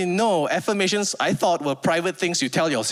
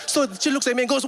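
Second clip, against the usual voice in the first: normal talking voice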